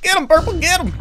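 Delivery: loud, high cry for help; panicked yell. Real, not acted. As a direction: scream for backup. Panicked call.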